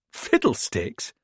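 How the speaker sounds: Fiddlesticks drawn out slowly through a laugh; amused disbelief at a silly word. A narrator's reading. Martha laughing at Kite's odd code word, slow and amused. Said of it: "Martha burst out laughing".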